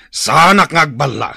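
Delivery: angry shout. Acted. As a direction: shout the threat in his face. Villain threatens the captive chief.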